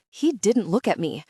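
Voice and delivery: plain reading voice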